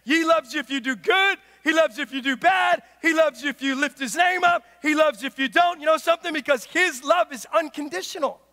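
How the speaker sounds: emphatic preacher, voice up; full-force preaching. Acted. Preacher telling the congregation of unconditional love, full-force preaching. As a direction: drive it home to the congregation.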